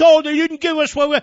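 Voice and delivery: high-pitched whiny mimicry; mock whining. Host mimics complaining committee chairmen, mock whine. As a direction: whine helplessly in a mocking high voice. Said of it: "whine like a bunch of stuck pigs".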